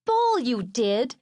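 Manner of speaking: put-on slow indignant kid voice; youngest brother Bruce. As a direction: drag it out like a protesting little boy. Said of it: "my youngest brother Bruce said".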